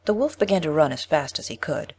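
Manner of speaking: ordinary delivery, nothing marked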